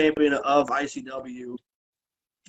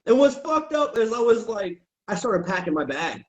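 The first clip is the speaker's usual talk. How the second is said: ordinary delivery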